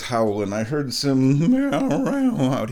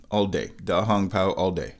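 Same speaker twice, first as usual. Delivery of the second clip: normal talking voice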